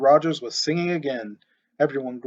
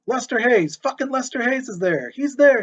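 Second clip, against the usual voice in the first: much higher and louder, wild cheering; ecstatic cheering; 10 semitones higher, 5 dB louder than usual. A narrator's reading. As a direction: scream with joy at the play. Fan cheering the game.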